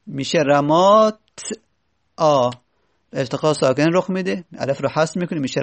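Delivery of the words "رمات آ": "رمات" halted on ت, pause, then آ by itself; unvowelled ت. Shown as a demonstration. Teacher showing the ت is originally vowelless, "رمات", gap, آ. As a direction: split off the آ after "رمات". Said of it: "چون که اصلش ساکنه".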